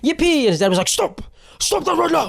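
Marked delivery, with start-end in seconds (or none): 0.0-0.4 put-on high-pitched child's cheer; imitated playful squeal; gleeful little kid. Little boy playing a game. 0.9-2.3 strained, hammed-up parental telling-off; yelling strict dad. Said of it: "His dad was still yelling at him".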